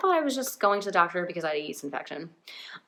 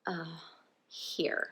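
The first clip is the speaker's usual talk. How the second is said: very soft, hesitant mumble, imitated; hushed, awkward imitated reply; awkward hesitation; 2 semitones lower, 13 dB quieter than usual. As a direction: hand it over awkwardly, barely voiced. Acting the guy's awkward hesitant reply.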